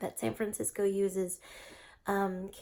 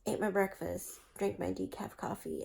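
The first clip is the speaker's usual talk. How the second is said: ordinary talk, nothing marked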